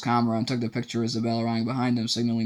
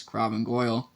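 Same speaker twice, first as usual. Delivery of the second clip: usual speaking voice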